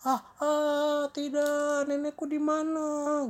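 very high, loud drawn-out shriek; hammed-up scream of panic; terrified wail.

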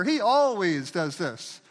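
slow, loud stress on "always"; exaggerated accusing "always"; mocking absolute. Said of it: "we teach our kids, don't use absolutes".

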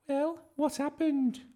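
put-on very high, squeaky child's voice; eager boy Eugene. A narrator's reading. Eugene waiting outside for Bertie, eager high question. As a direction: ask in a high, eager pipe.

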